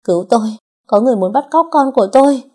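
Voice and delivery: high, loud, fast cry for help; shouting for help. A narrator's reading. Mother fleeing kidnapper, shouting loud for help. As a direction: scream it out while running away. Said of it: "miệng la lớn".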